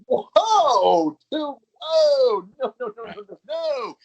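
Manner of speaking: high, loud startled whoa then fast nos; mock-alarmed high cries of no; shocked protest. Girls shocked in bed.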